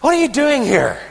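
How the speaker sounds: high-pitched challenge leaning on "here"; stress on here. Acted. God challenging Elijah hiding in the cave, high-pitched, pressing on "here". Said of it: "The emphasis seems to me, the implication is".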